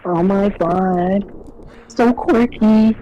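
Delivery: high, slow ditzy gush; quirky girl voice. Pretend. Mocking quirky random girl.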